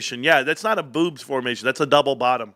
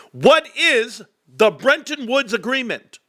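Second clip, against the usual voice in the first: Jeopardy answer; 7 semitones higher, slower than usual. Pretend. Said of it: "ask my wife a Jeopardy question".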